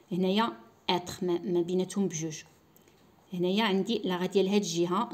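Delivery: ordinary talk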